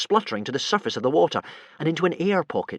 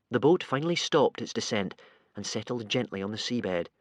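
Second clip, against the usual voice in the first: usual speaking voice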